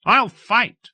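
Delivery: put-on high, loud defiant boy; a small boy bragging he'll fight; boy answering the captain defiantly; lusty child's cry. A narrator's reading. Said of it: "I cried lustily".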